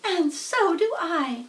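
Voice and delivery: high, slow tiny voice; name herself proudly; little spider voice. A narrator's reading. First spider names herself to Wilbur, high and slow. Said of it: "said Nellie".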